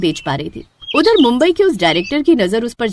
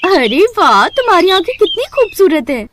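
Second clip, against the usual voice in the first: higher, louder amazed voice; a customer gushing over her eyes; admiring customer; 9 semitones higher, 4 dB louder than usual. Acted. Customer praising the girl's eyes, higher amazed voice.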